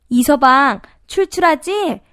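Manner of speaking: high-pitched, inviting call; mother-in-law offering a late-night snack; coaxing mother-in-law. A narrator's reading. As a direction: lift the voice into a coaxing question. Mother-in-law calls to son-in-law.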